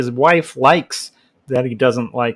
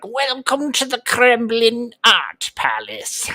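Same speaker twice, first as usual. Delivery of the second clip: much higher, slow mock demon host; 10 semitones higher, slower than usual. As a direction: greet like a hammy evil host. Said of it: "That's how Kremble will talk from now on".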